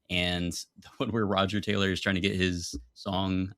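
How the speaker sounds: usual speaking voice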